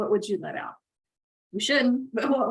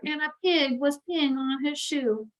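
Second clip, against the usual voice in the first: ordinary delivery, nothing marked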